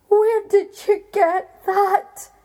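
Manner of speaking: high, loud, slow horrified question; the giant aghast at the egg. A narrator's reading. Giant seeing his heart in Jack's hand, high and horrified.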